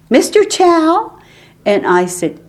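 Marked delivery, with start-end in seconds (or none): 0.1-1.2 high, slow, loud rising call; loud calling question. Retold or imitated. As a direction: call out the name down the line. Asking for Mister Chow on phone.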